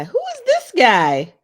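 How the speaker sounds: high, loud and slow in disbelief; can't believe what she's seeing; shocked disbelief. Real, not acted. Shocked at shooter's photo.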